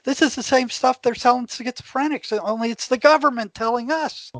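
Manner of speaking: amazed. Retold or imitated. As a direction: sound like you can't believe what the TV is saying. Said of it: "And I'm, I'm amazed".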